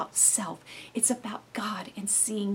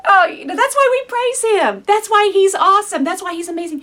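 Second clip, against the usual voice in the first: much higher and louder, joyous; exuberant praise; 10 semitones higher, 11 dB louder than usual. Real, not acted. Preacher praising Jesus, loud and joyful. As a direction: let the praise burst out.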